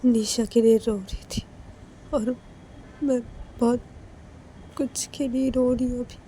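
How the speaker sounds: teary pleading. Acted. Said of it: "Manisha फिर से रोने लगती है".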